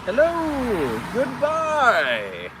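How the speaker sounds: high, falling hello then goodbye; high drawn-out mock-royal calls; singsong greeting. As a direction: wave like the queen to traffic. Waving to traffic.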